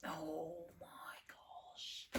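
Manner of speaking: slow, drawn-out whisper of amazement; awed whisper. Real, not acted. Card reader stunned by the card drawn, awed whisper.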